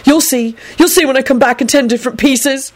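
high-pitched, cross warning. A narrator's reading. Neville warns his disbelieving mother, crossly. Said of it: "he added crossly".

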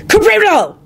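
very high, loud cry; screamed spell. A narrator's reading. Lupin buries his attackers with a spell, screaming. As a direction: scream out the incantation. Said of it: "he screamed".

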